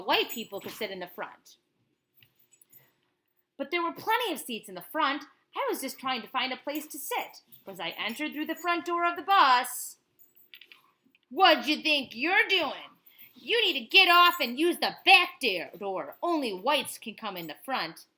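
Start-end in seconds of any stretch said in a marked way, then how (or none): 3.6-10.0 lively read-aloud of a picture book; reading to a child. Acted. Parent reads picture book aloud. 11.3-18.1 slow, louder barked order from the driver; bully her off the bus; angry bus driver. Acted.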